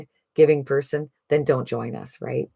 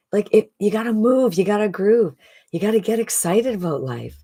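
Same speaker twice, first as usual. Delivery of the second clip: pep-talk rhyme; 6 semitones higher than usual. Real, not acted.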